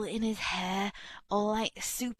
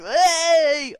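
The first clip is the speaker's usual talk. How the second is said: mock party yell; 9 semitones higher, 12 dB louder than usual. Imitates guy in photo.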